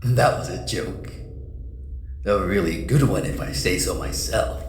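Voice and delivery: suave mysterious villain. Acted. Mysterious figure greets Jace in darkness.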